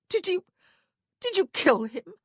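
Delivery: high, loud horrified question; shocked. A narrator's reading. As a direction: gasp out the question. Horrified sister asks.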